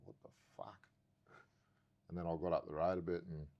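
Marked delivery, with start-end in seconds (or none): 0.1-0.9 breathless whisper of disbelief. Retold or imitated. Feeling the energy shift, a whispered stunned curse. Overwhelmed by sensation.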